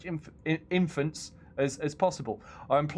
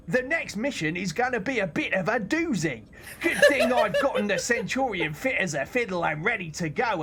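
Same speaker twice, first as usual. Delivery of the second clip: hammed-up British accent, pitched higher and louder; Farhad's British accent; 7 semitones higher, 3 dB louder than usual. Streamer reading the mechanic's message aloud, hammed-up British accent. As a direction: ham up a cheeky cockney British mechanic, laughing through it. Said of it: "have I gotta read it out in his accent".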